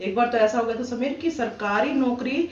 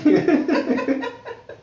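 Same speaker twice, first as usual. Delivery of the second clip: laughing; 6 semitones higher, 5 dB louder than usual. Real, not acted. Laughs after verse.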